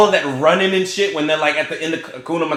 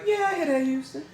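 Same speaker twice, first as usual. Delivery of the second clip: lightly mocked Houston accent, higher and softer; a hint of a Houston accent, pitched up; imitating a Houston accent; 10 semitones higher, 10 dB quieter than usual. Podcaster mocks a Houston line reading in the movie, light Houston accent. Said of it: "one or two line readings where I'm like".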